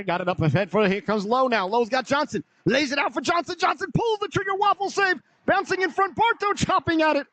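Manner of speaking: building to a high-pitched, urgent call; excited play-by-play. Real, not acted. Commentator calling a shot on goal, rising to high excitement. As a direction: pitch climbing fast as the chance unfolds. Scoring chance in live play-by-play.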